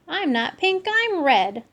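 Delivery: put-on high indignant kid cat voice; indignant kitty boy. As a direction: squeak it out, offended. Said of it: "said Skippy".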